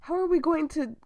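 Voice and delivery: loud, high, agitated questioning; alarmed board member. Acted. Board member fearing what investors will hear, loud acted outrage. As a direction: fire off outraged questions at the doctor.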